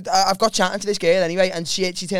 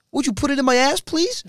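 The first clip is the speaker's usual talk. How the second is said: higher, mock-polite Norwegian request; put-on Norwegian accent, pitched up; 7 semitones higher than usual. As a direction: imitate the Norwegian girl, rising high. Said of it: "do a Norwegian accent".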